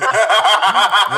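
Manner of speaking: a sudden, loud, real laugh; helpless loud laugh between names; loud burst of laughter. Real, not acted. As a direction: crack up out loud. Host cracks up mid-roll call.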